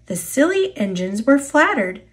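ordinary delivery, nothing marked

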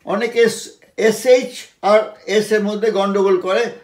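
ordinary talk

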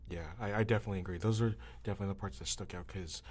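ordinary delivery

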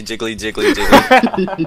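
high, giggling jelly sound; laughing creature noise. Acted. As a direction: squeal it through laughter. Voicing jelly monster noise.

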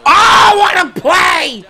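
high, slow, drawn-out holler; excited shout. Real, not acted. Gamer reacting to a big play, excited shout. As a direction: cry out in amazement at the play.

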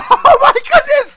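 high laughing shriek; screaming with laughter; shocked laughter. Real, not acted. Laughing at friend's bad luck.

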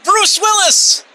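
2 high, slow, drawn-out call of the name; 3 stretch the name out way up high like an announcer; 1 Bruce Willis callout. Pretend. 4 host calling out the running Bruce Willis gag, high and slow.

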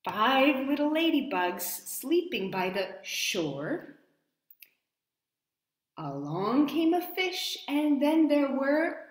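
sing-song rhyme rising up; reading to children. Acted. Storyteller repeating the verse for the children. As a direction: lilt the verse up to the question. Rhyme read aloud to kids.

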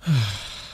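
short audible sigh; exasperated sigh. Acted. Partner keeps insisting they are fine, exasperated sigh. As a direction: let out a resigned breath.

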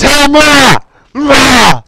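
high, loud shout for mum; calling out loud. Real, not acted. Gamer calls mum to show his win, high shout. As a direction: holler to mum in another room.

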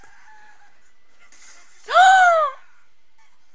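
long high gasp; loud shocked gasp. Real, not acted. Shocked gasp while watching.

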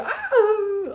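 high startled scream. Real, not acted. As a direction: shriek in fright. Caught by killer.

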